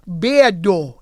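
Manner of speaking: ordinary talk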